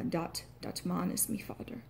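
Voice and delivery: quietly retry the Irish line; Irish accent. Shown as a demonstration. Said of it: "might end up with something like".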